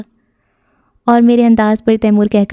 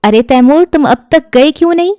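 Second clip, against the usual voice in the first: higher, louder surprised aunt; an older woman calling out in surprise; aunt bursting in; 7 semitones higher, 4 dB louder than usual. A narrator's reading. Aunt storms into the room, loud and surprised.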